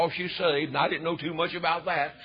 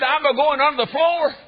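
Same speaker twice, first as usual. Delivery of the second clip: retold alarm; 6 semitones higher, 5 dB louder than usual. Frightened child retold at climax.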